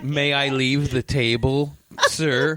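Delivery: mock polite child. Mocking polite child to cop.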